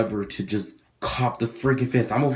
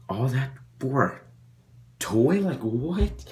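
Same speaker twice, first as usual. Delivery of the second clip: rising, higher, outraged incredulity on what; can't believe it's over a toy; disbelief; 4 semitones higher, slower than usual. Retold or imitated. Disbelief at the bleeding elbow.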